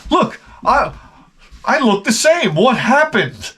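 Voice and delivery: high, fake-surprised mock voice; mocking vain woman. Comic mocking a vain woman on TikTok, high and falsely surprised. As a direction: play the smug woman pretending shock. Hot forty-year-old on app, mocked.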